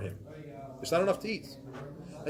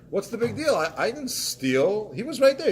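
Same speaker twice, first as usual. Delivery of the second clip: higher, indignant excuse-maker voice rising to a louder peak; mock defensive protest; 6 semitones higher, 4 dB louder than usual. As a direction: play the guilty one brushing it off, getting worked up. Mock self-justifying wrongdoer quote.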